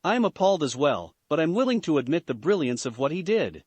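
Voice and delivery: plain reading voice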